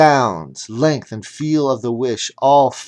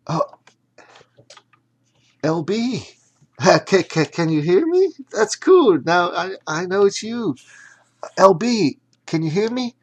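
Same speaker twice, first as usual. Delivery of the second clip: put-on high, bright excited girl voice; thrilled to hear the hat speak; girl bubbling with excitement; excited young girl; 8 semitones higher, 4 dB louder than usual.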